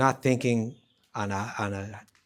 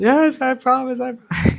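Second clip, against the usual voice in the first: very high, loud mock wail; hammed-up terrified promise, drawn out; frantic promise; 14 semitones higher, 12 dB louder than usual. Mother-in-law gives in to the threat, mock frantic shriek.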